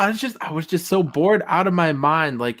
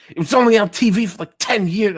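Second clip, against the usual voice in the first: lightly mimicked rant, rushed at first; mock indignant outburst; Quagmire; 3 semitones higher, faster than usual. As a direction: play at going off, a little higher. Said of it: "he's just going off".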